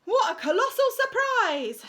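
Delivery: high, loud, slow sarcastic shout; children's sarcastic shout. Acted. Children mocking the teacher in assembly, loud and sarcastic. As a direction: sneer it out together. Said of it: "shouted the children".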